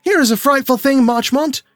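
distraught father bursting into the room; gasping in panic. A narrator's reading. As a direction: burst in frantic and breathless. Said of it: "he gasped".

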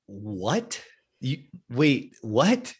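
halting, questioning mock surprise; lightly acted double take, rising at the end; baffled mother. Mom to son asking for a sporting goods store, baffled.

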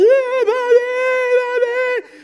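put-on very high, loud wailing child's "mommy"; mock crying child, shrill and loud; crying toddler. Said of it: "the little one starts crying and goes".

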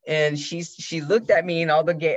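usual speaking voice

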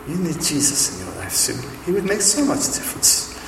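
mock German accent, climbing high; Margot's German accent. German woman urging Sue towards faith, imitated German accent. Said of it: "more German than Birmingham".

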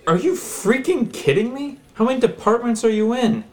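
high, incredulous outburst; exasperated. Acted. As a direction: throw up your hands in disbelief. Caller exasperated.

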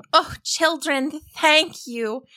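high, slow sobbing thanks; tearful relief. A narrator's reading. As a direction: thank them through relieved tears. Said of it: "she sobbed in relief".

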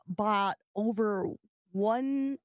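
usual speaking voice

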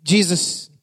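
ordinary delivery

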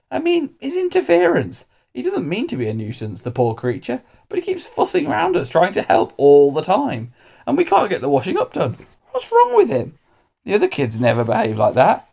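high, swooping exasperated voice; complaining dinner lady. A narrator's reading. Dinner lady complaining that the boy keeps getting in the way, exasperated. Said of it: "went to Mister Majika to complain".

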